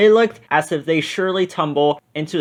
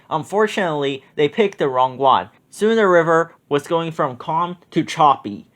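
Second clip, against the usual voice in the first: usual speaking voice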